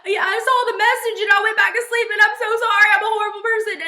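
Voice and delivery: high, loud and fast frantic apology; imitating panic. Boyfriend calling after sleeping through her message, frantic high rush. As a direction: gush it out in a rush, guilty and scared. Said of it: "completely panicking".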